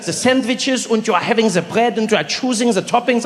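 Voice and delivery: put-on high, insistent German-accented explaining. Friend explaining the sandwich chain, German accent. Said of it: "He's like".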